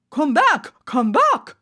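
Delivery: judge shouting. A narrator's reading. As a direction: call them back as the judge. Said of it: "the judge shouted".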